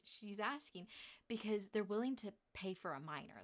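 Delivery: ordinary talk, nothing marked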